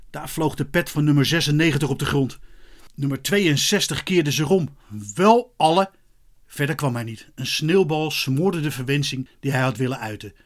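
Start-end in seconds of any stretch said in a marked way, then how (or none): 5.1-5.9 angry half-finished oath, louder and higher; loud exasperated swearing; cursing. A narrator's reading. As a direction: burst out with a curse, cut short. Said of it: "de bierton naast zich vloeken".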